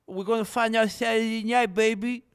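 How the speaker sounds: high, loud hype impression; Max Holiday. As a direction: belt it out like a fighter's sound drop. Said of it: "did you actually think that was Max Holiday".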